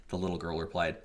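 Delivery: plain reading voice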